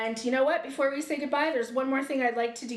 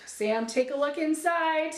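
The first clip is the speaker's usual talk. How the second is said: normal talking voice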